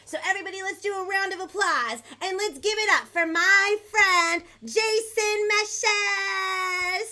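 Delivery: high, building announcer call; rousing stage intro with a long-held final name; guest introduction. Real, not acted. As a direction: hype up the guest, stretching out the name.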